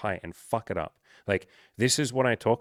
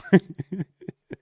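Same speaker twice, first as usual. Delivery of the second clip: high laugh; 9 semitones higher, 6 dB louder than usual. Real, not acted. Laughing at his own bad pitch.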